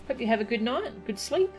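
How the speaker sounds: ordinary talk, nothing marked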